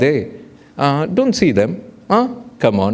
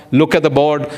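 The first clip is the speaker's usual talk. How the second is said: ordinary delivery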